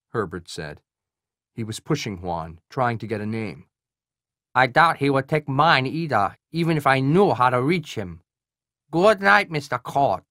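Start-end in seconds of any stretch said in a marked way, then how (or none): 4.6-10.2 nasal Korean colonel. Acted. Voices Colonel Hwan on phone.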